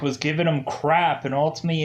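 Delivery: ordinary delivery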